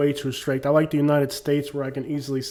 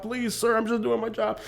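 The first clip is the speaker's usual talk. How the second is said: much higher, softer mock pleading; begging cop; 8 semitones higher, 3 dB quieter than usual. Imitated cop begging the YouTuber to leave him be, much higher and softer. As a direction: whimper it like a cop begging for mercy.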